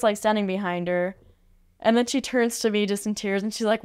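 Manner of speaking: ordinary talk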